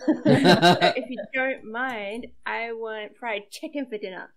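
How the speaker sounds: loud, hammed-up celebrity demand; mock diva demand. Celebrity daughter ordering dinner from Mom, mock diva. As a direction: make a grand demand, drawn out at first.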